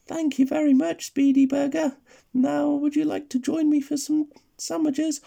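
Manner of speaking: high little granny voice; sweet granny voice. Acted. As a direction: speak like a doting old granny. Granny voice in the story.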